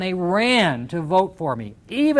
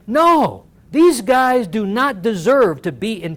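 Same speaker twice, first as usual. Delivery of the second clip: loud, slow, higher outburst of retold fury; furious; 4 semitones higher, 6 dB louder, slower than usual. Back room betrayal, telling the party off, furious. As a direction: fling it out like the outrage is back. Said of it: "And I was furious".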